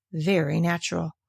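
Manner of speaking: ordinary delivery, nothing marked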